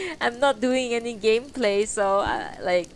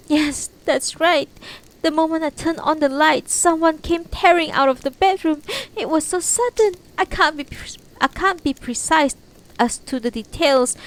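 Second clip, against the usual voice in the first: frightened witness voice; 5 semitones higher, 6 dB louder than usual. Acted. Tamayo retells the scare to police.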